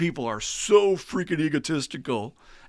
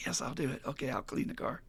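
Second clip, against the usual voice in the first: soft, swallowed obedience after the outburst; grudging compliance; 2 semitones higher, 8 dB quieter than usual. Acted. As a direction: give in meekly, muttering under your breath. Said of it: "And he has to go".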